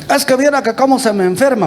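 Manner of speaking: high-pitched, lightly mocking imitation of a parent; lightly voiced pleading parent. Voicing another parent's excuse.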